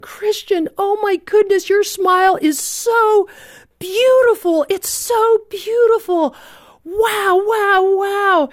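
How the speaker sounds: high, awed exclaiming; breathless high awe over a child's smile; amazed delight. Retold or imitated. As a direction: gush with wonder at his smile. Narrator exclaims at boy's smile.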